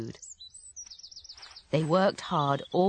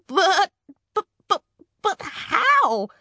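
high, loud, stammering disbelief; astonished husband, squeaky-high and halting; flabbergasted; 5 dB louder than usual. Acted. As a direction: stammer it out, pitched way up in shock.

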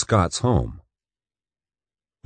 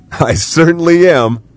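plain reading voice

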